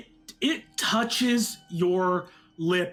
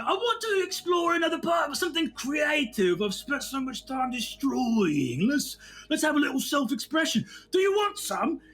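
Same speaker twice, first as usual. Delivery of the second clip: high, fast excited daydream; mock-wistful barbarian; 8 semitones higher than usual. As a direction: gush about creative hobbies. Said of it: "and you're like".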